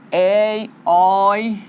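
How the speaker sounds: "Ei, oi" pronunciados devagar, destacando os ditongos; ditongos em destaque. Shown as a demonstration. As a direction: separe bem o som de "Ei" e "oi". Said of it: "olha, a junção das vogais". (professora mostra os ditongos aos alunos)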